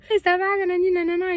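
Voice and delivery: high bright pretend hello; fake friendly greeting. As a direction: greet him like an old friend. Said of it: "donc j'ai fait genre".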